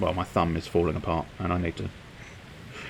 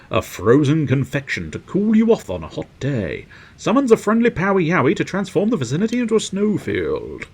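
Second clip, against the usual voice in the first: high, swooping product announcement; announcer read; 8 semitones higher, 5 dB louder than usual. Acted. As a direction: present the invention like a showy advert. Reads out item description.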